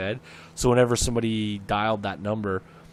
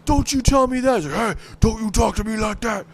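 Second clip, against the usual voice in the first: much higher and louder, an angry put-on yell; yelling back; 12 semitones higher, 9 dB louder than usual. Caller yells back at the prank guy in a high, loud put-on voice. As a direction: bellow it back in a silly voice. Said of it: "yelling at the guy back".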